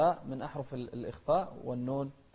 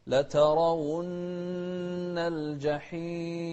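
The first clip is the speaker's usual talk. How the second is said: measured chanted recitation, slightly raised; Quran recitation demo; 4 semitones higher, 3 dB louder, much slower than usual. Shown as a demonstration. Teacher recites for the student in measured chant.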